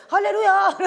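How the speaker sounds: loud, high hallelujah; joyful hallelujah. Real, not acted. Preacher crying out hallelujah to the congregation. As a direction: shout praise to God. Exclamation of praise.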